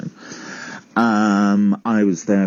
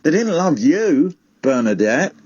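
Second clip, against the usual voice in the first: insistent retort; 7 semitones higher than usual. Acted. Snaps back in acted quarrel.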